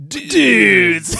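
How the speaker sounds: high, drawn-out surfer dude call; Jeff Spicoli. Acted. Risen corpse hails his friends, Spicoli-style. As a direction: stretch it out loud like a stoner greeting pals. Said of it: "Like, uh, fucking Jeff Spicoli".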